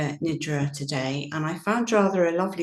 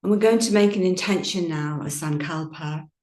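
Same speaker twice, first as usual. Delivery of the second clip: guided meditation. Acted. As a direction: ease the listeners gently into stillness. Guided yoga nidra meditation.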